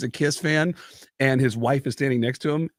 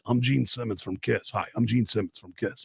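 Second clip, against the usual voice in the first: [name] voice; 2 semitones lower, 5 dB quieter than usual. Retold or imitated. Imitating Gene introducing himself.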